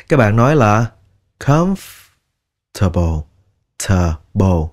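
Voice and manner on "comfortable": comfortable slowly in two parts, comf, then light tə-bo; light puff. Shown as a demonstration. Teacher models the right way, comf... tə-bo. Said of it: "nhẹ thôi".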